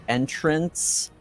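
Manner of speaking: ordinary talk, nothing marked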